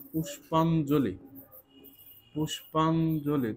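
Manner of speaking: পুষ্পাঞ্জলি drawn out slowly, syllable by syllable; slow spelling-out. Shown as a demonstration. Teacher spelling পুষ্পাঞ্জলি aloud for students, slowly. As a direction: sound out পুষ্পাঞ্জলি slowly for the class. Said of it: "পুষ্পাঞ্জলি বানানটা আমরা কিভাবে লিখতে".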